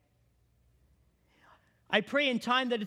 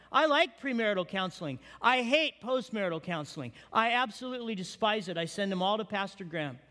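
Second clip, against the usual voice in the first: ordinary delivery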